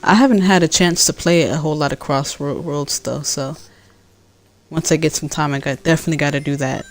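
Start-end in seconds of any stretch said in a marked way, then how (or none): none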